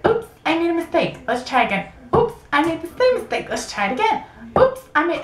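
high, airy ditzy cashier voice; singsong oops of a clueless clerk; mock flustered cashier. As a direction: play the clueless register lady, chirpy and high. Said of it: "She was like".

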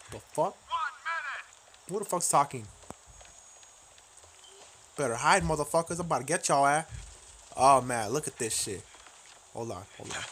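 usual speaking voice

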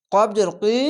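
ordinary talk

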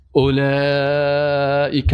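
اولئک with its long vowel stretched slowly; drawn-out vowel. Shown as a demonstration. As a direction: hold the long vowel of اولئک well beyond normal. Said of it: "مد و اخلاف". («اولئک» با مد کشیده و آهسته)

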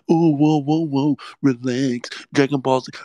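hammed-up fan telling him to relax; mocking a fan. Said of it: "Dragon Ball Z fucking sucks too".

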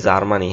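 জার্মানি as "zar-maa-ni", Z and sounded R; common mispronunciation. Shown as a demonstration. Teacher quoting how we usually say it, Z-start জার্মানি. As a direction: say জার্মানি with a buzzing Z and open vowels. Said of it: "আমরা কি বলি".

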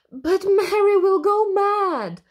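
high, loud panicky protest; worried wail of a little brother; spluttered. Acted. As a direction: blurt it out in alarm. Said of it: "spluttered Alby".

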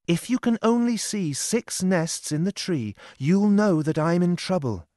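put-on frightened young Lorna. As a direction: voice her like a scared young woman pleading.

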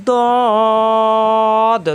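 teacher reciting দদ with full four-alif stretch; long drawn dad. Shown as a demonstration. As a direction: chant দদ for four beats, then bounce the last দ. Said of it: "জিহ্বার গোড়ার কিনারা উপরের মাটির দাঁতের গোড়ায় লাগবে".

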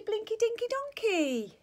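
ordinary delivery

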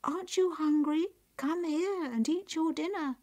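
high, loud enticing invitation; high-pitched magic plate voice; calling out. Acted. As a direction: tempt her over to eat. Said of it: "the plate called out".

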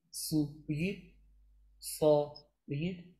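ordinary talk

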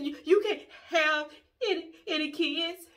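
high, loud mock sobbing, stammering; pretend crying. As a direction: stammer it through fake tears. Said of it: "pretending like I'm crying".

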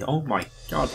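usual speaking voice

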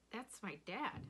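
mildly puzzled, rising at the end; a touch of confusion; confused. A narrator's reading. Rob hearing his dad's call, confused.

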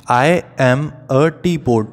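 put-on slow, drawn-out recitation for children; recited rhyme line. Shown as a demonstration. Teacher reciting a nursery rhyme to the class, slow and sing-song. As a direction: read it slowly so the class can follow.

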